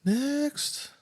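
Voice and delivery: squeaky high call of next; silly high call. Pretend. Co-host calling for the next clip in a squeaky high voice.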